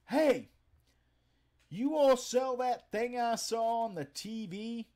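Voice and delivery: put-on high, loud, slow drunk holler; drunk customer. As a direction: play the drunk at the drive-thru speaker. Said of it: "And he says".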